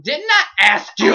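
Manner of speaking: loud, high mock yell; exasperated outburst. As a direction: yell back at the teacher, acting fed up. Retold outburst at teacher.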